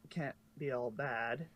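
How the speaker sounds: ordinary delivery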